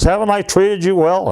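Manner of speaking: high, mock-indignant question; retold indignation. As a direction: imitate an aggrieved, angry boss. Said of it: "he's really mad".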